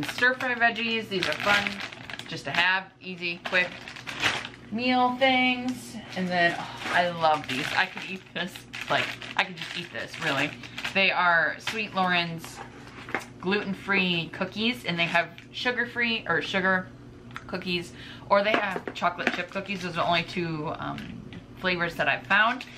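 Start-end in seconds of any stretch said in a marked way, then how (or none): none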